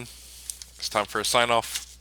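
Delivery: usual speaking voice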